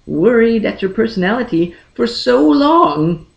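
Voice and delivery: plain reading voice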